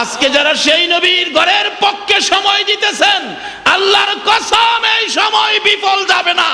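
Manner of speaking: very high, chanted preaching shout; full-force preaching. Real, not acted. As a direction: proclaim it to the crowd, high-pitched and drawn out.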